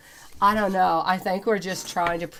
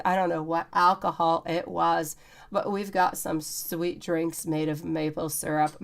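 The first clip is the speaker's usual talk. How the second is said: normal talking voice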